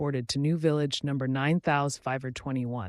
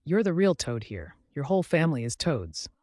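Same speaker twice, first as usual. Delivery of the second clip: ordinary delivery, nothing marked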